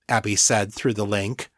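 ordinary delivery

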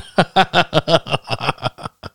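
high breathy burst of laughter; real laugh. Real, not acted. Laughing at sister's joke.

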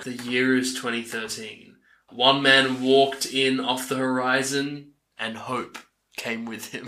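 slow, grave trailer narration; dramatic trailer voice. Acted. Host reciting the film's epic opening, trailer style.

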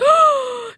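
sharp gasp. Retold or imitated. Hearing phone noises.

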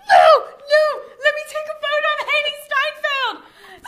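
very high, loud squealed protest; mock frantic fangirl. Imitating friend desperate for a photo, hammed-up shriek. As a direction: shriek it like a fan being held back.

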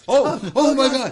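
high mock panic, crying for mom; panicked shriek. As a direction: shriek it like you're falling. Panicked mock fall reaction.

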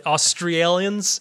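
drawn-out, hammed-up Australian accent attempt; botched Australian accent. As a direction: stretch it slowly into a bad Australian accent. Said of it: "slaughtered the Australian accent there".

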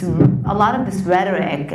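ordinary delivery

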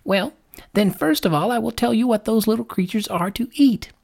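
high-pitched lark voice; high storybook lark; lark character. Acted.

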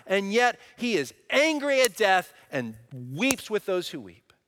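preacher at full force over death and glory; impassioned preaching. Acted. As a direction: lift the congregation with rising fervor. Preaching climax.

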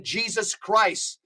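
ordinary talk

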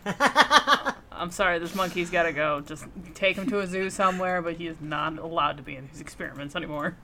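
hurried, brushing it off; fast dismissive. Acted. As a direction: rattle it off quickly. Jokingly voices fed-up researcher.

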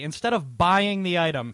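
usual speaking voice

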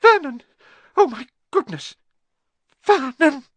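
very high, loud strangled cry; horrified, choked exclamation; choking with shock. A narrator's reading. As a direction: cry out as if about to faint. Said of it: "made a choking noise".

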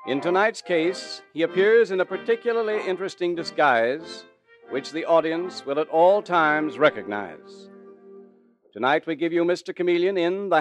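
put-on booming old-time radio intro; old radio announcer. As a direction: announce it like a 1940s broadcast. Announcer opens the radio show.

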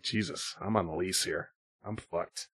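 low, hushed dismay; muttering to self. Retold or imitated. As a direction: mutter it under your breath in despair. Dismay on realizing he's stuck.

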